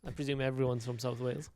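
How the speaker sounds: ordinary talk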